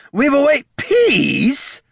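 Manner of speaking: high, loud, drawn-out screech; mocking shriek. A narrator's reading. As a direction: shriek it back with cruel scorn. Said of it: "Grignot shrieked".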